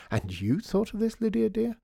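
put-on very high, cooing question to a child; high-pitched, admiring lady; marvelling society lady. A narrator's reading. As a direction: coo at the clever little girl.

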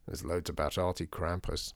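low, soft deadpan echo; unimpressed. A narrator's reading. Father answering Lydia's excitement about the article, unimpressed. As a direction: dampen the enthusiasm, low and quiet.

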